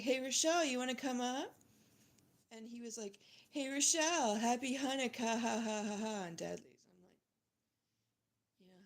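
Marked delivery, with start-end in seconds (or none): 0.0-1.5 loud, higher, hammed-up hey; mock loud greeting. Mocking the host who calls her up, loud and hammed-up. 3.5-6.1 loud, higher, fake jolly greeting with a ha-ha laugh; mock pushy host. Mocking the host's holiday taunt, loud and fake-jolly.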